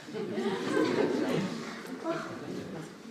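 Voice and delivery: soft unvoiced laugh. Laugh after her bitter list.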